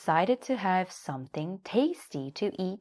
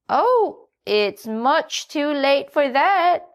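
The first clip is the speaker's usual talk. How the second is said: high, louder hen voice; a bright, clucking hen; Nanny Hen; 10 semitones higher, 8 dB louder, slower than usual. A narrator's reading. Hen greets the little donkey in a high voice. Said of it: "said Nanny Hen".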